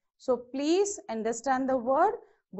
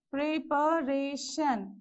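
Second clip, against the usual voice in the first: "preparation" slowly split into four beats; syllable by syllable; much slower than usual. Shown as a demonstration. Teacher counting syllables for students, "preparation" slowly broken up. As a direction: sound out "preparation" one syllable at a time, slowly. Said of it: "So how many".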